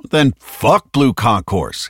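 slow, emphatic, angry retort; snapping. A narrator's reading. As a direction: snap back, leaning on each word. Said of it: "Hicks snapped".

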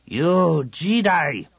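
slow, high contemptuous snap; snapped. A narrator's reading. Commander snapping at the Jedi, slow and contemptuous. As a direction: spit the word at him. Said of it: "the commander snapped".

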